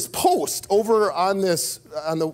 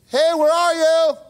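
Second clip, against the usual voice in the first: louder, higher call through the woods; 9 semitones higher, 5 dB louder, slower than usual. Retold or imitated. Father searching the woods calls for his lost kids, shouting. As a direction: shout out into the trees.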